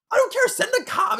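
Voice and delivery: very high frantic plea; acted hysterical caller; panicked demand. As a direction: beg for help in a high panic. Panicked victim demands help.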